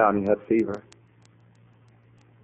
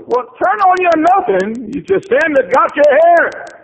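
high, loud preacher's cry; preaching at full force; 9 dB louder than usual. Acted. Preacher crying out Job's losses to the congregation, full force. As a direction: shout the loss out over the pews.

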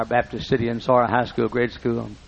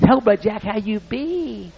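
Wood's way of talking; 10 semitones higher than usual. Retold or imitated. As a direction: play the gentle old farmer greeting his preacher. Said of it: "he talked like this".

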